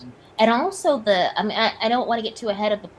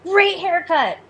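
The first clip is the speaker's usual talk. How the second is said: sassy; 9 semitones higher, 6 dB louder, slower than usual. Acted. Sassy compliment, high and far.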